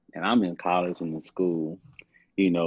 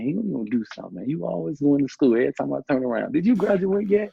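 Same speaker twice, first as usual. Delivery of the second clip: much higher, louder mocking take of the father; mocking dad; 8 semitones higher, 4 dB louder than usual. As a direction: ham up dad asking the same thing. Voicing father, mocking him.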